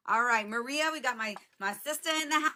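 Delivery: ordinary delivery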